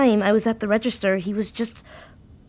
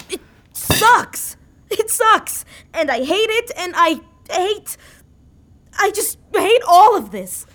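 higher and louder, venting despair; upset outburst; 6 semitones higher, 3 dB louder than usual. Acted. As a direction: let it spill out, rising with each hate. Tanya's outburst about her fear.

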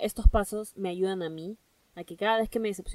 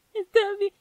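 sulky agreement; 12 semitones higher, 8 dB louder than usual. Pretend. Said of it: "Y Lucrecia".

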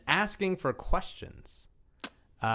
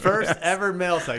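segment announcer; 5 semitones higher, 4 dB louder than usual. Shown as a demonstration. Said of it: "Here we go".

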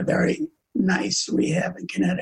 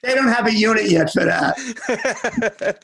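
laughing; 6 semitones higher, slower than usual. Real, not acted. Joke about dual addiction.

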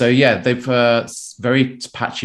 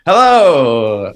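a big, much louder and higher hello, stretched out; energetic hello; 6 semitones higher, 11 dB louder than usual. Acted. Opening his Oxford preview after being handed over, with a booming energetic hello. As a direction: burst in to kick the segment off with energy. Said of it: "say hello in a highly kind of energetic way".

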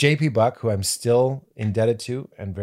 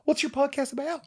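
high, soft, sweet-natured voice; Jack McBrayer; 5 dB quieter than usual. Retold or imitated. Comic retelling of a fellow comedian's question, high sweet impression. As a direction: pitch it way up, softly. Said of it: "like Jack McBrayer".

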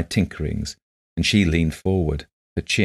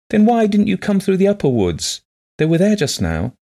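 high, louder woman's voice, lively questioning; eager blind woman; 6 dB louder than usual. A narrator's reading. Blind woman asking visitor about the upper woods, eager and high. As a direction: lift it high, gently reproachful.

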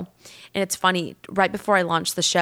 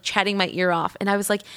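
ordinary delivery

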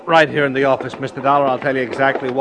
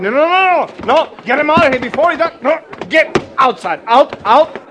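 high, loud, fast shooing of a dog out of the store; 11 semitones higher, 4 dB louder than usual. Acted. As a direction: chase the dog out, yelling. Grocer shoos a dog out.

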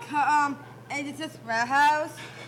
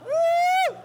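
excited whoop; 10 dB louder than usual. Real, not acted. Cheering as mom is called.

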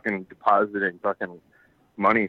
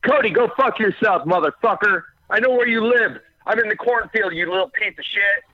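high screaming threats; threatening yell; 12 semitones higher than usual. Acted. Prank voicemail turns abusive.

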